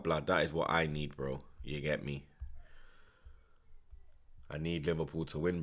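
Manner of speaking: ordinary delivery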